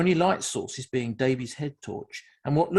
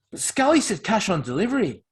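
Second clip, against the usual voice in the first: higher, louder unsure protest; faltering, unsure; 6 semitones higher, 6 dB louder than usual. A narrator's reading. Objecting that Scally promised cash on delivery, faltering and unsure. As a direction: falter as you object to the deal. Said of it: "voice was faltering and unsure".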